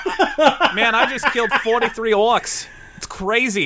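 put-on bragging dwarf with a Boston accent. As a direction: trade war stories like a Boston local. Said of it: "Dwarves are from Boston now".

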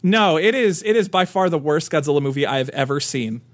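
usual speaking voice

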